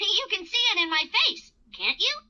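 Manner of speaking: ordinary delivery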